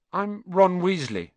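plain reading voice

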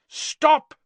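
high, loud cry to halt; urgent shout; yell. A narrator's reading. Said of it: "yelled a voice he recognized".

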